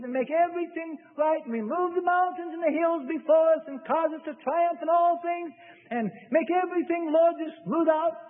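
high, sing-song begging for an easy life; pleading prayer. Retold or imitated. Preacher parodies a believer begging God for an easy life, high and pleading. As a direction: whine a wish list at God. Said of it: "How would you like to say".

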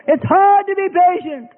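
high, slow whiny voice; slow high whining plea; impatient believer. Acted. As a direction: drag it out pleadingly.

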